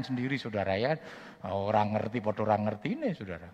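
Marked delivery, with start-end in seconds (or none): none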